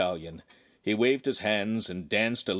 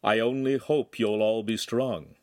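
ordinary delivery, nothing marked

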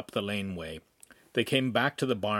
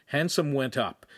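ordinary delivery

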